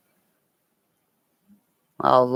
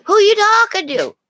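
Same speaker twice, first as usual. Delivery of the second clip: much higher, louder silly dog voice; voicing the dog; 10 dB louder than usual. The dog asks who she talks to, high silly voice. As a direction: squeak it out as the nosy dog. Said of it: "he's going".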